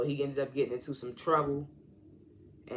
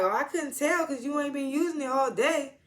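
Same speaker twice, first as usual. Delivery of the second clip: sassy teasing; 11 semitones higher than usual. Retold or imitated. Ashley sasses the rude uncle.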